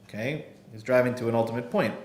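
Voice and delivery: ordinary talk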